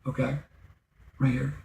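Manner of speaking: ordinary delivery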